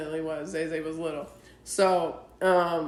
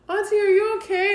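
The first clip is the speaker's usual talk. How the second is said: little niece; 12 semitones higher, 5 dB louder than usual. Retold or imitated. Aunt voices her little niece.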